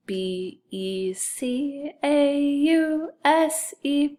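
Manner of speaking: spelling aloud. Shown as a demonstration. Teacher leading sight word review, spelling aloud. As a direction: draw out each letter, pitch rising as you go. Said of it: "and spell it".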